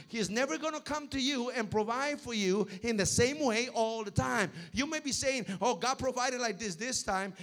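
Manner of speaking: loud, rising sermon delivery; full-force preaching. Acted. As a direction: preach it out to the congregation.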